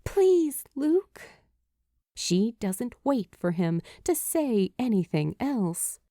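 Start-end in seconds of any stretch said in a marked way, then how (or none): 0.0-1.2 pleading. Acted. Julie pleads with Luke.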